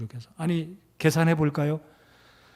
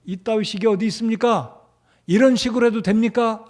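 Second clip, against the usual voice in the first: higher, indignant protest; arguing indignantly; 7 semitones higher, 4 dB louder than usual. Retold or imitated. Pastor voicing a person arguing back, indignant. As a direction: push back like someone who was wronged. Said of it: "사람들과 따지고 논쟁할 때".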